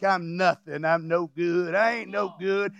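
low, mopey whine of a self-pitying believer; lightly voiced mope, low and soft; mock self-pity. Preacher mimics a believer putting himself down, low mopey whine.

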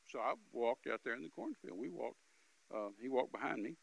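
ordinary talk, nothing marked

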